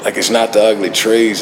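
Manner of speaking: ordinary delivery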